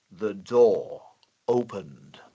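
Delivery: very low, suspenseful delivery; ominous dramatic reading. Shown as a demonstration. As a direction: pause heavily before "opened". Example sentence read for dramatic ellipsis.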